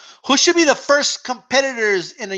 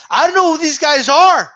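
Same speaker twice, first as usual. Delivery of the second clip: higher, louder mock of a clueless uploader; 8 semitones higher, 6 dB louder than usual. Streamer quoting an uploader reading a resume, mock clueless. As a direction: imitate the uploader, pitched up and loud.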